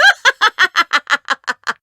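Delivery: high cackle; triumphant witch cackling; wicked witch laugh. Acted. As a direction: cackle with glee. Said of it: "Doctor Daffodil laughed".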